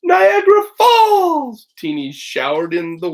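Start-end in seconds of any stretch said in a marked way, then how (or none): 0.0-1.7 high, slow, ringing cry of delight. A narrator's reading. Little girl crying out in delight at the waterfall. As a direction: stretch it out high with pure joy. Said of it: "Teeny cried out in delight".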